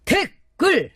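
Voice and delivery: high barked drill call; drill sergeant. Acted.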